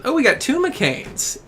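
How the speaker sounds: high, slow surprised exclaim; drawn out in high surprise at the screen. Real, not acted. Streamer notices two McCains, high slow surprise.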